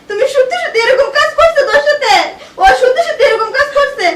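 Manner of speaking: high, tearful outburst; anguished disbelief. Acted. Wife confronting husband over betrayal, anguished and tearful. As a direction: wail the question, close to crying.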